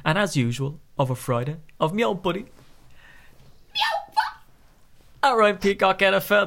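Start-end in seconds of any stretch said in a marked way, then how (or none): 1.8-6.5 strained voice cracking into a squeak; hoarse, voice breaks on a high note; man flu. Real, not acted. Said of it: "That really hurt my throat that time".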